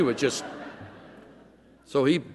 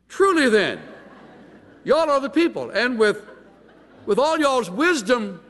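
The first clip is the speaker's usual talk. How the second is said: high, loud mocking sarcasm; biting, sing-song sarcasm, pitched up high; sarcastic; 12 semitones higher, 4 dB louder than usual. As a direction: pour scorn on them as the know-it-alls. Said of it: "having learned a little sarcasm".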